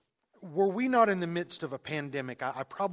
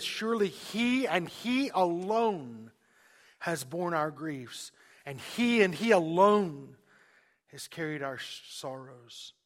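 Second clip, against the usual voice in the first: slow, "he and he alone" hit hard; emphatic paraphrase of the verse; stress on he alone; slower than usual. Shown as a demonstration. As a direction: lean on "he and he alone" each time. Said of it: "it could say something like this".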